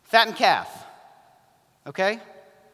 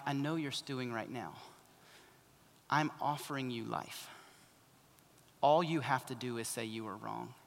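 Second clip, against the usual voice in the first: gentle coaxing; 5 semitones lower, 9 dB quieter than usual. Acted. Parent coaxing a stewing child.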